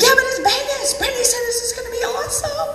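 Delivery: high-pitched, eager plea to a husband; excited pleading. Retold or imitated.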